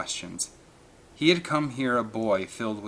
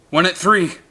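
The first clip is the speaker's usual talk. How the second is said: callout; 8 semitones higher, 10 dB louder than usual. A narrator's reading. Marine calling target.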